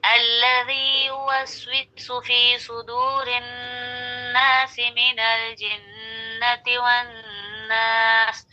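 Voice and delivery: slow, measured recitation joining the verses; Quranic recitation. Shown as a demonstration. Teacher reciting the verses joined together for students. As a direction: recite it slowly, linking नासि into the next verse. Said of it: "कैसे?".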